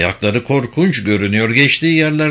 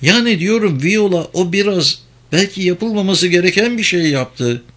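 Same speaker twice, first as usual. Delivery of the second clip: high-pitched woman's role; Penelope, speculating; 7 semitones higher than usual. Acted. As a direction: lift the pitch, working out a theory.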